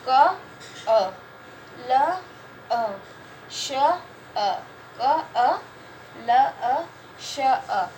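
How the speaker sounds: क, अ, ल, अ, श, अ said one sound at a time with pauses; teacher sounding out कलश for a class. Shown as a demonstration. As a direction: split कलश into single sounds, each one separate. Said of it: "कलश में छह ध्वनि आ रही है".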